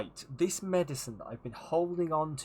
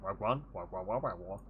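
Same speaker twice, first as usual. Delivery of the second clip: imitating game voice; 4 semitones lower than usual. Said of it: "it sounded like".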